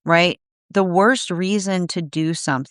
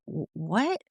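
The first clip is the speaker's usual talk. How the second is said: baffled what; 9 dB quieter than usual. Retold or imitated. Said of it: "You'd look at somebody and be like".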